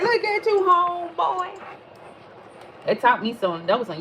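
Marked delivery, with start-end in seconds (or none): 0.0-1.6 high, excited, impressed; impressed exclamation. Retold or imitated. Impressed by the lottery winner's cars, high and excited. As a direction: cheer him on up high.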